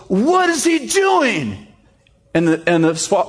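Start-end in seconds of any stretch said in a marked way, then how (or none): 0.1-1.7 high, drawn-out cry of disbelief; frantic manager wailing in bafflement; exasperated shout. Retold or imitated. Manager shouts to father-in-law.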